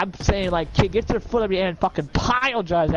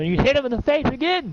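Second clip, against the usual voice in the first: excited narration; 8 semitones higher than usual. A narrator's reading. GM narrates punch climax.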